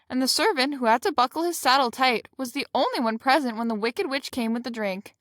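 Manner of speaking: plain reading voice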